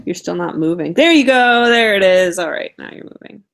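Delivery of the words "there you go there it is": loud, high, celebratory; sudden bright burst of success; real relieved cheer. Real, not acted. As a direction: call it out as the picture comes back. Cheers as frozen video moves again.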